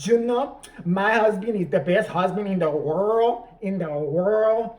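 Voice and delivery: loud, dragged-out mock Mexican accent; bad Mexican accent. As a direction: ham up a thick fake Spanish accent, very slowly. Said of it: "saying that accent".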